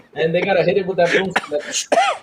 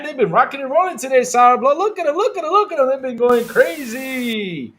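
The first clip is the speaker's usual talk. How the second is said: excited hype; 7 semitones higher, 3 dB louder than usual. Real, not acted. Hyping the chat.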